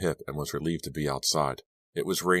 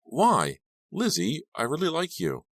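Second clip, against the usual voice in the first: pleading; 11 semitones higher than usual. A narrator's reading. Lucas pleading, hurt.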